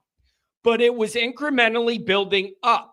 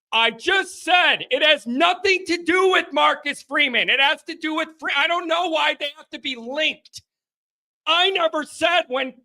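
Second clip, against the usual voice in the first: higher, pressing frustrated reply; heated rant; 7 semitones higher than usual. Real, not acted. Host snapping back at LSU fan, exasperated. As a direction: argue it out, exasperated. Arguing with caller.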